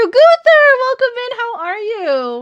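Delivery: high, loud happy welcome; excited greeting. Real, not acted. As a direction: greet a friend with delight. Greeting a new viewer.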